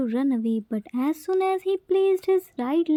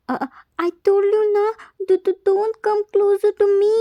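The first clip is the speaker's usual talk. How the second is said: high, loud, fast stammering warning; panicked threat; 5 semitones higher, 6 dB louder, much faster than usual. A narrator's reading. Terrified child threatening the nurse to stay back. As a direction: stammer a frantic warning to keep away.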